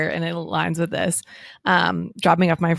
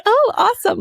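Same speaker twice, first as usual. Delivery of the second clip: very high, louder squeal of delight; retold delight, pitched way up; excited child; 5 dB louder than usual. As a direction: imitate a thrilled four-year-old. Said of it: "Like, so excited".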